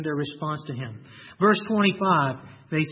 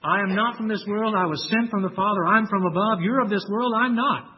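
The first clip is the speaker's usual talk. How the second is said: emphatic declaration; 4 semitones higher, 4 dB louder than usual. Acted. Preacher paraphrasing Jesus' claim.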